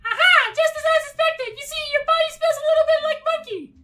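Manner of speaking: very high, loud squeal; screaming princess voice. Acted. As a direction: shriek it like a spoiled princess. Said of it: "she screams everything as well".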